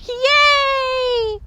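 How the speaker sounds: long, high, loud hurrah; crowd's jubilant yell; cheering. A narrator's reading. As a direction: hold out a gleeful cheer. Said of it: "the crowd cheered".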